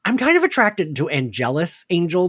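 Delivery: ordinary delivery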